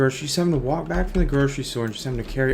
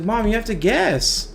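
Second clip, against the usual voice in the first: a lightly voiced, higher, pleading girl; insistent little girl; 8 semitones higher, slower than usual. Little girl in the joke begging mom to guess, lightly voiced kid. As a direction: nag it like a kid who won't let go.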